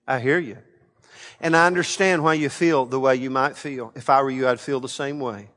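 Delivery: low, soft, understanding voice; calming. Retold or imitated. Preacher showing angry people that he hears them, calming. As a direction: settle an angry person with quiet reassurance. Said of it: "I would say things like this".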